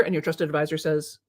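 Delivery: usual speaking voice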